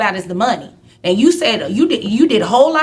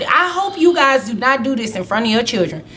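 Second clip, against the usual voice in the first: normal talking voice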